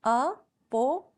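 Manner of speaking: "अपो-" slowly split as a, po; divided into syllables. Shown as a demonstration. Teacher repeats the parts for students, "अपो-" slowly syllable by syllable. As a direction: hold each syllable of "अपो-" apart. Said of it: "अलग-अलग parts में divide करेंगे".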